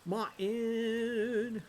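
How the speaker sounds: MARTIN held long and high; drawn-out call. Acted. Calls to game character, drawn out.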